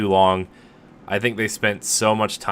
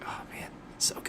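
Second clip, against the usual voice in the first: breathy whisper of delight; 16 dB quieter than usual. Retold or imitated. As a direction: whisper it like a guilty pleasure. Gushing over the game.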